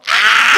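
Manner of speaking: very loud mock scream; re-enacted collective "Ah!", loud and sudden; imitated group scream. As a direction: let out a big retold shriek of surprise. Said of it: "We all go".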